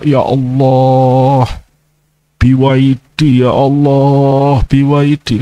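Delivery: slow, stretched-out mock begging to Allah; drawn-out pleading prayer. A preacher mimics a believer begging Allah for a BYD car, slow and pleading. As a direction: drag out each word like a whiny prayer. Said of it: "Begitu kamu minta".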